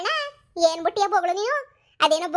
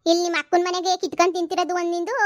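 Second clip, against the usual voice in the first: ordinary talk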